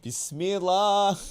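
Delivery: high, slow, drawn out Arabic; Arabic accent. Pretend. Arabic phrase said for laughs.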